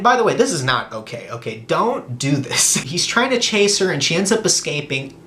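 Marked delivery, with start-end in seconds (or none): none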